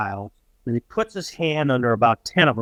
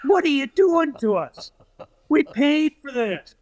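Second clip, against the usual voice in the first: apoplectic; 11 semitones higher than usual. Retold or imitated. NBC guy protesting outside the locked locker room, apoplectic. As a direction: imitate an outraged TV man, pitched way up. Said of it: "he's apoplectic".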